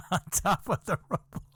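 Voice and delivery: high squeal of laughter into words; can't hold back the giggle; laughing. Real, not acted. Teller cracks up at his story.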